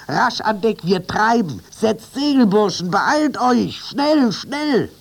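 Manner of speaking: high, loud urgent commands; shouted orders. A narrator's reading. Captain driving the toy animals on deck, urgent high shouts. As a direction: hurry the crew with rising urgency. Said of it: "rief er über seine Schulter".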